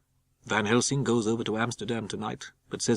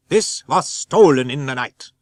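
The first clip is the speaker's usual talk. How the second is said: emphatic reveal; 3 semitones higher, 9 dB louder, slower than usual. Acted. Van Helsing reveals theft.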